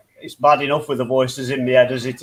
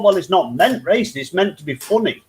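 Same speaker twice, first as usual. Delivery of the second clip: usual speaking voice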